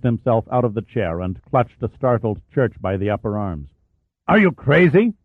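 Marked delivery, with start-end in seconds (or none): 4.3-5.1 loud, higher furious roar; roaring captain. Acted. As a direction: roar it at the officer in drunken rage. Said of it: "he roared".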